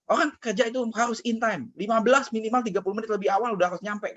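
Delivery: high, insistent rant; angry scolding. Retold or imitated. Uncle demanding staff arrive early, angry lecture. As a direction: lay down the rule in a high, cross voice. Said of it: "dia sebegitunya marah".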